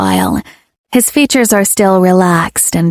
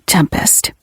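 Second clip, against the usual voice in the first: whispered name; 2 semitones lower, 4 dB quieter than usual. Acted. Lover murmurs her name.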